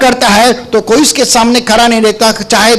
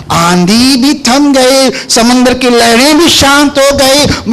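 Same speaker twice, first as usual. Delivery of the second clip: slow dramatic; 3 semitones higher, slower than usual. Acted. Dramatic storm calming.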